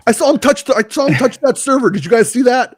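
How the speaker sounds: high, loud tattling; mock accusing bystander. Bystander telling on a man touching server, mock alarm.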